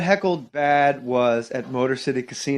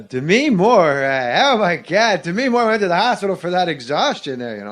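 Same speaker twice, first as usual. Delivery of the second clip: Norm Macdonald; 3 semitones higher than usual. Acted. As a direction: ramble with big swooping stresses. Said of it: "I'm doing Norm".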